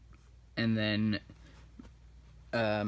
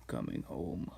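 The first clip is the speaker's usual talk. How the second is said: low menacing echo; 7 semitones lower, 7 dB quieter than usual. Retold or imitated. Tai Lung menacing repeat.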